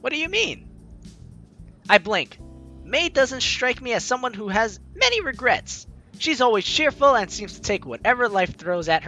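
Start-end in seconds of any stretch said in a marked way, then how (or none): none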